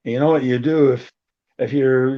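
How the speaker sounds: ordinary talk, nothing marked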